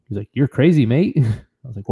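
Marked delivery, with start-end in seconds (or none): 0.3-1.2 high-pitched mock Australian accent. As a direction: imitate an Australian calling someone crazy. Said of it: "he had an Australian accent".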